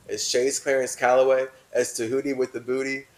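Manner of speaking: normal talking voice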